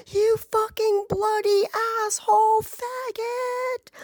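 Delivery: high mock screech, relentless; high-pitched imitation of a screaming woman; retold screaming rant. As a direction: imitate her screamed tirade, pitch climbing and held high. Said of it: "just so loud".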